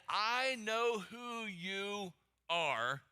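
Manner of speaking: very slow, deliberate quote; drawn-out words. Acted. Preacher reading the demon recognizing Jesus, drawn out slowly. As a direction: stretch out each word slowly.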